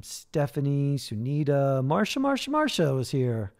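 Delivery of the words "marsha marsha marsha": playful sing-song. Real, not acted. Calling out a name playfully.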